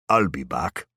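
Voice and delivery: put-on a light Austrian-American accent on "I'll be back"; tossed off in a hint of Austrian-American accent. A narrator's reading. Hero winks at his trainer, in a light Austrian-American accent. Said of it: "in my best Austrian-American accent".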